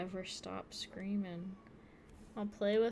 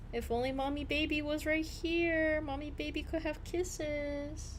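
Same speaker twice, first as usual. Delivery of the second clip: high, sing-song pet talk; 6 semitones higher, 5 dB louder than usual. Real, not acted. Calling the cat in the other room, high cooing baby talk. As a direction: coo to a pet out of reach.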